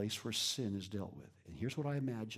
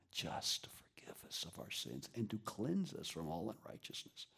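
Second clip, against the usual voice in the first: softer, hushed scripture; reverent whisper; 4 semitones lower, 5 dB quieter than usual. Acted. Preacher whispering the verse about forgiveness. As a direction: whisper the promise gently.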